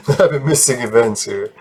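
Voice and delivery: real chuckle breaking into the words; laughing while talking. Real, not acted. Gamer correcting his own habitual maybe, laughing while talking. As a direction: talk through a laugh. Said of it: "stop laughing while I'm talking".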